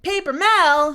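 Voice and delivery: high, loud offended "Paper Mel?"; indignant outburst. Real, not acted. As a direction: snap back at the chat, offended. Reacting to chat name.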